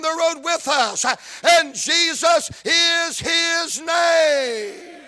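rhythmic, rising gospel preaching; full-force preaching. Acted. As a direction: preach it to the congregation, building to a shout.

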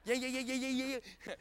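rapid repeated little yips; mock a purse dog's yapping. Preacher teasing owners of purse dogs, mock yip-yapping. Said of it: "it yip yaps".

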